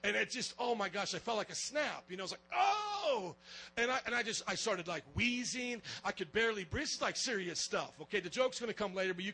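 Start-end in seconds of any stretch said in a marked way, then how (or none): 2.5-3.4 sudden high yelp of pain; retold cry of pain from a chokehold. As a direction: gasp out as something snaps. Wrestling choke, felt a snap.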